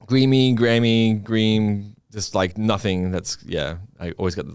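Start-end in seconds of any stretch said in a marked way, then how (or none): 0.1-1.9 "Greamy, Grammy, Green" as botched versions of one name; mispronounced name. Shown as a demonstration. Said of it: "like, the wrong thing".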